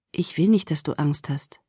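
low, soft and tender; soft murmur. Acted. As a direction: murmur it gently. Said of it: "nicht mehr als ein sanftes Murmeln".

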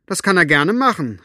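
high, loud retort; calling back. A narrator's reading. Elinor answers Maggie from the house, calling back. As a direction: throw the answer back across the distance. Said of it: "rief Elinor zurück".

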